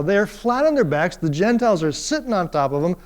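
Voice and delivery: normal talking voice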